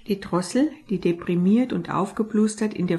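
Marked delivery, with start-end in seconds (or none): none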